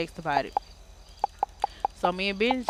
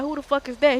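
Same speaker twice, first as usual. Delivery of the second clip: high, loud, alarmed demand; alarmed question; 7 semitones higher, 5 dB louder than usual. Retold or imitated. Asking his friend who was shooting, loud and alarmed. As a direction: fire it off like you just heard shots. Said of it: "I'm like".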